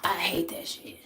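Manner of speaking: voiceless whispered repeat. Shown as a demonstration. Repeating her complaint to viewers, whispered. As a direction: breathe it out as a whisper. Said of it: "repeated in whisper".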